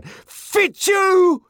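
high, loud, frenzied yell; frenzied shout. A narrator's reading. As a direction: lash out like a madman. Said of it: "Like a madman".

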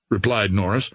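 ordinary delivery, nothing marked

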